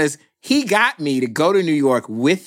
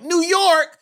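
high, shocked "New York?"; can't believe what he just heard; shocked disbelief; 13 semitones higher than usual. Retold or imitated. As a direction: squeak it out in disbelief.

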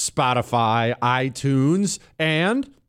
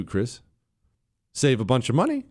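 ordinary delivery

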